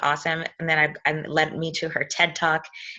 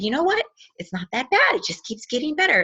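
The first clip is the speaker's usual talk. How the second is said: higher-pitched imitation of an upbeat mother; upbeat retold mom; 8 semitones higher than usual. Daughter quoting her mother after the solo road trip, upbeat. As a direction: voice mom's pleased report, pitched up.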